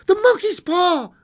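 very high, loud frenzied cry; wild cry. A narrator's reading. Wife remembers the monkey's paw, crying wildly. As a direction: shriek it out wildly. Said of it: "she cried wildly".

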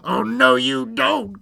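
high, loud creature retort; loud, teasing refusal; defiant cry. Acted. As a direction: cry out to overrule the child. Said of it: "cried the fly-by-night".

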